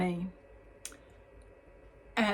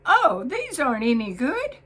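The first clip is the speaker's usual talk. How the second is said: much higher and louder burst; blurted out; 6 semitones higher, 9 dB louder than usual. Retold or imitated. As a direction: blurt it out in dismay, loud and high. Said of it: "she blurted out".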